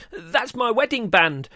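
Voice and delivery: high, loud protest; indignant shout. A narrator's reading. Mum shouting after the mallard, indignant high shout. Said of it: "she shouted".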